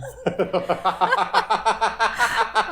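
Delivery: high Wicked Witch cackle; evil cackle. Real, not acted. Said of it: "that was my Wicked Witch laugh".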